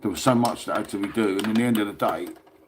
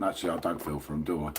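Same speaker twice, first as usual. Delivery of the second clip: quick, much softer and lower throwaway correction; sly quiet aside; 7 semitones lower, 8 dB quieter, faster than usual. Real, not acted. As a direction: drop the voice and take it back with a smirk. Takes back sympathy for rivals.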